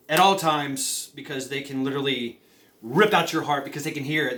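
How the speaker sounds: ordinary delivery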